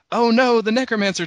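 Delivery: high, loud hammed-up fright; a playful pretend gasp of fear; mock alarm. Group pretends fright at the necromancer, high and loud.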